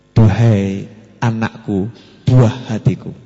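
slow, low loving address; tender. Shown as a demonstration. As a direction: speak to your dear child. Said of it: "manggil anak sing halus". (ucapan mesra pelan)

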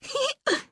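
high little laugh; thin girlish giggle. A narrator's reading. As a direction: giggle thinly in the dark. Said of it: "тоненько засмеялась я".

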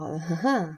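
a mild, pained, hollow little laugh; a touch of shrill anguished laughter; strident painful laugh. A narrator's reading. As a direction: laugh as if it hurts. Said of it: "Elle éclata d'un rire strident et douloureux".

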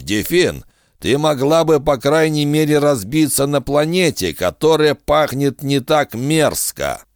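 a hint of a smug bargaining villain; sly bounty hunter. Kusaka voiced in narration.

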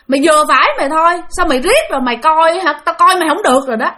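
angry scolding. Acted. Bà nội tức giận la ba. (bà nội tức giận mắng, giọng cao và to)

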